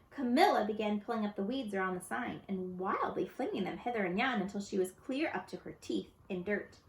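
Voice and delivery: ordinary delivery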